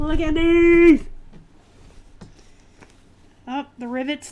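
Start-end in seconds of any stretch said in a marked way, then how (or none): 0.0-1.0 slow, loud, thrilled reveal with a laugh; excited. Real, not acted. Reseller excitedly shows viewers the jean backs, loud and thrilled. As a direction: savour it, nearly laughing with glee. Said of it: "by my excitement".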